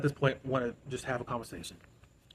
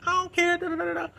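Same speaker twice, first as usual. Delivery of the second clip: much higher, louder, dismissive falsetto; squeaky mock Rain; 4 dB louder than usual. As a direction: mimic her brushing them off in a silly squeak. Mocking Rain dismissing the guards.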